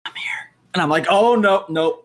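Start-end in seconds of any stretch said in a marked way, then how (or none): 0.0-0.5 breathy ghostly whisper right in the ear; faint whisper. Retold or imitated. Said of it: "faintest whisper I hear". 1.1-2.0 high, loud frightened cry of no; panicked outcry. Retold or imitated. Panicked by ghost whisper.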